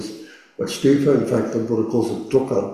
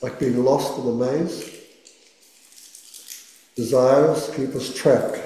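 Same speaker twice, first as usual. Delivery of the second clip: guided meditation. Acted. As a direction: gently guide the listener into stillness. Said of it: "let's do our first meditation".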